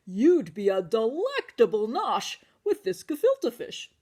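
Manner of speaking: lightly voiced old grandpa; Grandpa Solly. Grandpa Solly offering the matzah man a spot by his fish. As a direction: tempt him with the fish.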